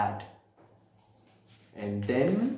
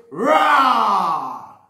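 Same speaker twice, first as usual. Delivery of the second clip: loud, higher storybook monster roar; 5 semitones higher, 7 dB louder than usual. A narrator's reading. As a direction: let out a big drawn-out "rah" like a monster. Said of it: "and he roars".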